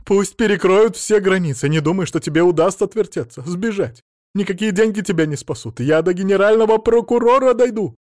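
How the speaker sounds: high, loud tirade; furious threats. A narrator's reading. Mother-in-law threatens him with courts, furious tirade. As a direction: pile threat upon threat.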